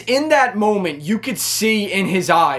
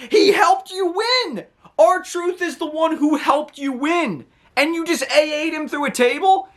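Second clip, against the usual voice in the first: shrill disbelief, much higher and rising, half laughing; high incredulous outburst; 10 semitones higher than usual. Real, not acted. Fan yelling at John Cena for slamming R-Truth, high and disbelieving. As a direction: cry out at him in total disbelief. Speaker's outrage at Cena's attack.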